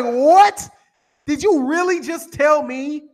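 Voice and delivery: high, startled what, then slowing down; incredulous disbelief. Retold or imitated. Confronting a commenter in disbelief, high and slow. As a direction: let the shock stretch the question. Retold shock at viewer's claim.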